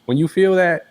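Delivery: ordinary talk, nothing marked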